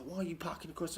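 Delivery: high-pitched mock Cockney grumble. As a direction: imitate a whining Cockney neighbour. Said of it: "he puts on a Cockney accent".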